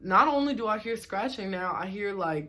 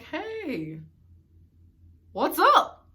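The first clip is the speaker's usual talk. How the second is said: higher, sing-song fake-friendly hello; mock casual greeting; 9 semitones higher, 4 dB louder, much slower than usual. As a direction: greet her sweetly while clearly annoyed.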